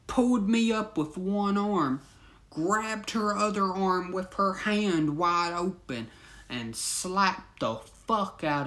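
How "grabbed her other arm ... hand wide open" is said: ordinary talk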